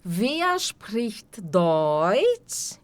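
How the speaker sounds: "Wer spricht Deutsch?" stretched out very slowly; very slow demo. Shown as a demonstration. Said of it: "teacher models question".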